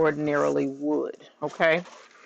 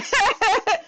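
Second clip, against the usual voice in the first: high delighted giggle; excited cackle; giddy laugh. Real, not acted. Giddy laugh over keeping shirt.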